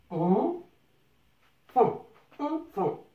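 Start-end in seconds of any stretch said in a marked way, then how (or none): none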